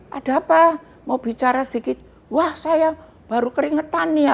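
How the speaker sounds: lightly hammed-up voice. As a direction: call back through the door. Retold dialogue at door.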